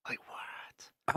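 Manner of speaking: hushed, voiceless whisper; soft, incredulous whisper; whispered disbelief. Real, not acted. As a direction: whisper it as if stunned. Mock disbelief at film's age.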